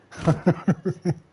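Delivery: short real laugh, high and loud. Real, not acted. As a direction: break into a laugh. Laughing at "don't ask.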